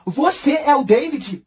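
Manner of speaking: high, slow, baffled; confused indignation. Retold or imitated. Lívia to the wrong boy, high and slow. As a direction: ask it slowly, totally lost. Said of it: "indignada, levantou e falou assim".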